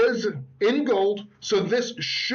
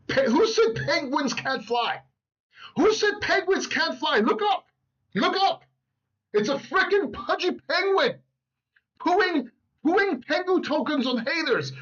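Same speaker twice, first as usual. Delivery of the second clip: high, excited bit that climbs even higher; comedic excited hype; 8 semitones higher than usual. Pretend. To his viewers, hyping the Pengu coin as a comedy bit, high and excited. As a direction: ham up the flying penguin gag. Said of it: "my comedic act right there".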